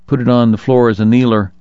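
ordinary delivery

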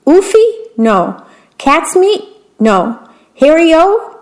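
high guessing queen. A narrator's reading.

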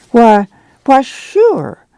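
sure jumps way up, caught off guard; flustered surprise. A narrator's reading. Ma caught off guard when the manager asks for coffee, flustered. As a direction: stammer it out, startled into hospitality.

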